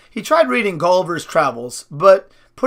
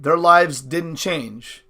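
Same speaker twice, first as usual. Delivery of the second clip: usual speaking voice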